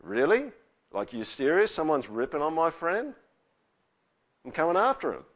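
high, loud, affronted challenge; acted protective outrage; indignant friend. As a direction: square up in defence of a mate. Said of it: "Friends just go".

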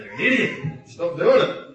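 playful mock rebuke, half laughing; mock scolding. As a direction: brush off the helper with an amused snap.

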